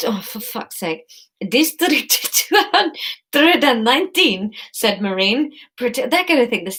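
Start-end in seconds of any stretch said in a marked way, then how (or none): 1.5-4.5 put-on high, stretched-out Spanish accent; badly faked Spanish accent, slow and high; attempted Spanish accent. Courier pretending to read a label, botched Spanish accent. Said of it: "this accent comes with a hand gesture".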